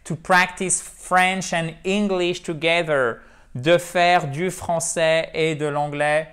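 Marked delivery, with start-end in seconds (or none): 0.1-3.2 slow careful English model line; model English reading. Shown as a demonstration. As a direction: read it out as the model sentence. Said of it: "une belle et une bonne façon de s'exprimer en anglais". (lecture lente en anglais modèle)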